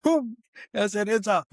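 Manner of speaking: gleeful announcement of the posted photo; triumphant boom. Retold or imitated. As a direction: crow it out in triumph. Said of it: "I was like".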